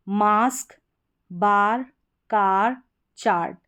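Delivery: "मास्क, बार, कार, चार्ट" each with a long open "aa"; long aa sound. Shown as a demonstration. Teacher reads out example words for learners, long-aa vowel. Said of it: "ए का उच्चारण आ हो रहा है".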